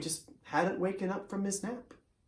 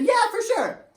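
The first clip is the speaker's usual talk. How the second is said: much higher, louder quick agreement; voiced horse Tempo; 5 dB louder than usual. Tempo humouring the bandit leader, much higher and louder. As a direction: toss off an eager yes as the horse.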